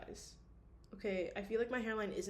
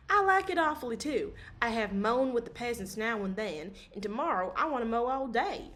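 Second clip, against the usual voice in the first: higher, louder eager voice; keen to mow all day; eager Levin; 3 semitones higher, 7 dB louder than usual. Acted.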